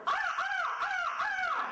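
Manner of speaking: very high, slow drawn-out crow caws; crow alarm caws. Retold or imitated. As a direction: caw like an upset crow on a branch.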